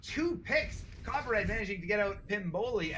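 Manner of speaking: high, loud rush of a play call; excited play-by-play. Real, not acted. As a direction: call the fight as it breaks open. Live play-by-play.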